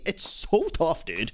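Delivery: ordinary delivery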